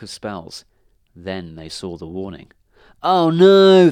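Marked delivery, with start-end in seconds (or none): 3.0-3.9 loud, high wail of dismay; dismayed cry. A narrator's reading. Witches crying out at the warning, in loud dismay. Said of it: "they cried".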